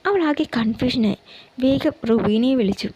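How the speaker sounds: ordinary delivery, nothing marked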